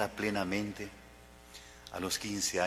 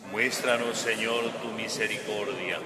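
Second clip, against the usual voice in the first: congregation response; 4 dB louder than usual. Real, not acted. Assembly answers the prayer together.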